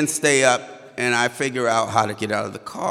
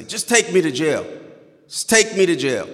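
higher, raised-voice plea; pleading refusal; 6 semitones higher, 4 dB louder than usual. Retold or imitated. Driver giving up before the officers, pleading loudly. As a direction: beg insistently to be arrested. He pleads rather than obey.

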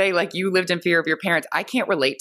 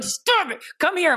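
high-pitched mock parental order; mock scolding; 13 semitones higher, slower than usual. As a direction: act out snapping at a child, voice way up. Acting out scolding her son.

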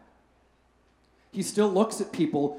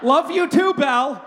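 louder, higher hammed-up replay of the slip; cringing replay; 8 semitones higher, 6 dB louder, slower than usual. Pastor replaying his slip to the phone agent in mock horror. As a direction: relive the embarrassing line with mock horror.

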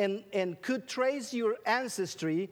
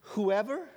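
"whoever" drawn out and lifted; emphasis on whoever. Shown as a demonstration. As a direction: lean hard on "whoever" and let it rise. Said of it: "you see the emphasis on".

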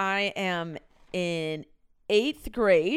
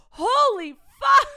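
shocked; 8 dB louder, slower than usual. Real, not acted. Reacting to own diary.

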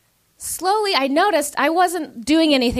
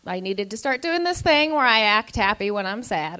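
normal talking voice

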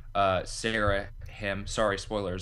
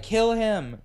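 mock urgent command; 13 semitones higher, 6 dB louder than usual. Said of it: "she's like".

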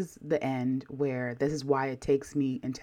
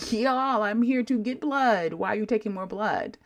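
exasperated; 6 semitones higher than usual. Retold or imitated. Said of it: "I'm like".

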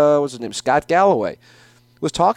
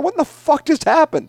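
much higher, stunned imitation of the host; stunned disbelief; 11 semitones higher than usual. Chuck Todd reacting to Giuliani on air, imitated high disbelief. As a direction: gasp it out like you can't believe it. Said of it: "He's going".

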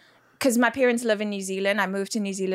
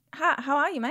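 higher-pitched New Zealand greeting; Kiwi accent; 6 semitones higher, faster than usual. Shown as a demonstration. Guest showing host how New Zealanders sound, Kiwi accent. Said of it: "how do they sound".

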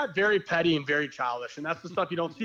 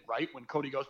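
ordinary talk, nothing marked

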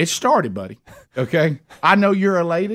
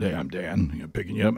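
lower, softer, easy radio-man delivery; Dan's laid-back radio voice; 8 semitones lower, 8 dB quieter than usual. Retold or imitated. Dan the driver greeting Rick, low laid-back radio voice. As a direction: lay back like an old DJ. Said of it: "he talked like this right here".

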